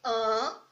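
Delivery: A closed and nasalised into "an"; closed A sound. Shown as a demonstration. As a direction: say "an" as the closed vowel, not an open A. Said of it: "vai ficar com som de an".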